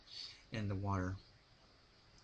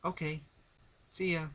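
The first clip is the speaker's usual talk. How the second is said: casual call-out; 8 semitones higher than usual. A narrator's reading. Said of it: "Rainbow yelled".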